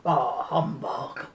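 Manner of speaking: slow, low grumbling scrooge; grumpy humbug. Pretend. Grandma pretending to be a Christmas grump, low slow grumble. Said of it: "or I might be a bit".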